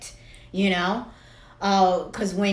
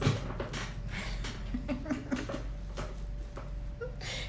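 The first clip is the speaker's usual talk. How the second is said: giggling; 8 semitones higher, 12 dB quieter than usual. Real, not acted. Laughing about cookie dreams.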